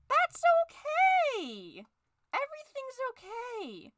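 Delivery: very high, slow sung okay; manic cheer. Acted. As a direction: cover the anger with a bright high sing-song. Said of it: "Falky's going insane".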